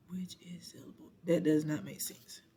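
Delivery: whispered aside to self, very soft; barely audible confused whisper; puzzled muttering. Real, not acted. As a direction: murmur it under your breath, puzzling it out. Puzzled aside over garbled question.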